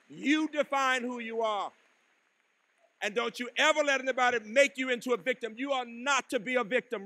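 a preacher's rising exhortation; emphatic call. Real, not acted. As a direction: drive it home to the graduates. Urging graduates not to be victims.

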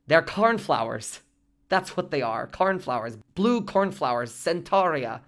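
desperate, defiant. A narrator's reading. Molly naming flowers for the doctor, calling out desperately. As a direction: call out the answer in defiance. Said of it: "she called out desperately, defiantly".

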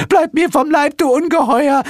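very high, loud shriek of revulsion; panicked screech. A narrator's reading. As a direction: screech at the monster to keep away. Hel hides from the monster.

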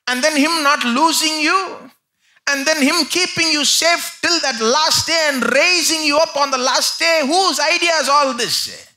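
preacher's climactic shout; rising preaching shout. Acted. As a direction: drive the crowd up to the question. Building sermon climax.

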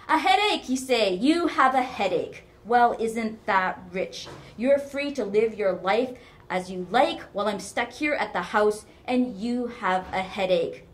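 loud, high, indignant sarcasm; mocking mother. A narrator's reading. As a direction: throw the excuse back with scorn. Mother throws daughter's excuse back.

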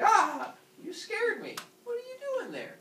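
hammed-up high startled yelp into questioning; yelp, then stay high and flustered; comic high-pitched fright; startled Father Sky. Startled Father Sky confronts son.

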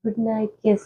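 soft drowsy plea for a kiss; half-asleep, murmuring the request; sleepy murmur. Real, not acted. Wife drowsily asking husband for a goodnight kiss, soft murmur.

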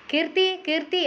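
calling a name long and high toward the house; father calling his daughter from the shop, drawn out. A narrator's reading. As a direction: stretch the name out, calling someone inside. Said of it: "അയാൾ നീട്ടി വിളിച്ചു".

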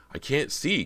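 high, alarmed realisation; panicked. A narrator's reading. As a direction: gasp it out in sudden alarm. Panicked thought after blinding blast.

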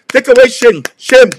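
usual speaking voice

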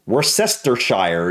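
Worcestershire slowly as wur-SESS-ter-SHIRE; spelling pronunciation. Shown as a demonstration. Reactor showing how the word looks, spelling pronunciation. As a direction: sound out every part of Worcestershire, SESS stressed, ending like shire. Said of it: "is how it looks".

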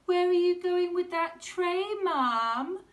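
put-on high, loud little girl asking; loud child's voice. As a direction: pipe up like a nosy child. Child questions mum in story.

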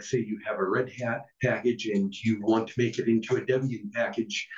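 ordinary delivery

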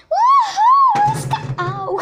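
high loud triumphant whoop; cheering whoop. Real, not acted. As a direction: whoop with joy then laugh. Escaped, cheering.

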